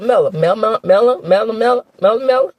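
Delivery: MELLA rattled off for Melody, last syllable dropped; mock mispronunciation. Vlogger mocks how Wanda says Melody's name, mimicking a mispronunciation. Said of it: "she can't even say Melody's name".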